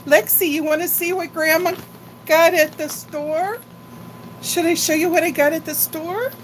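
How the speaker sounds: high, playful voice to a grandchild; high animated grandma voice showing her granddaughter the haul. Acted. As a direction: tease the little one up high. Grandma voice to Lexi again.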